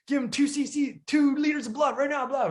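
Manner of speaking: urgent ER doctor. Retold or imitated. Urgent ER staff orders.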